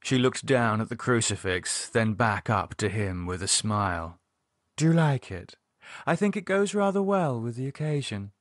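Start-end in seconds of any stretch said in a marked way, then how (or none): 4.8-8.3 Lydia voice. A narrator's reading. Lydia showing off crucifix.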